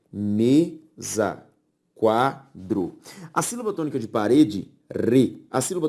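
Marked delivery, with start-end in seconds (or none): 0.1-1.5 "Mesa" in two separate beats with a pause between; split into syllables. Shown as a demonstration. Teacher separates mesa for the class, syllable by syllable. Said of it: "ao separá-las". 1.9-3.0 "quadro" in two separate beats with a pause between; split into syllables. Shown as a demonstration. Teacher separates quadro for the class, syllable by syllable. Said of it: "ao separá-las".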